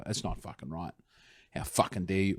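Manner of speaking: low, quiet indignant grumble; muttered outrage. Retold or imitated. Australians grumbling about politicians' travel, quiet outrage. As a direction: grumble it like an offended nation. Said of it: "the whole country gets together and go".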